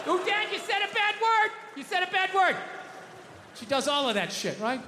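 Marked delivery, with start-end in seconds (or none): 0.1-2.5 put-on high, sing-song child scolding; daughter's tattling voice. Daughter telling dad he said a bad word, high tattling. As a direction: play the kid catching dad swearing. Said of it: "daughter quoted".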